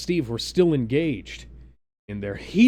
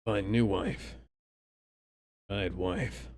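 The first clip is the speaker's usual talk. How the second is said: lower, softer broken-English Bucky; 5 semitones lower, 4 dB quieter, slower than usual. Acted. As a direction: go low and stiff like a brainwashed soldier. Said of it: "prompting the latter to say".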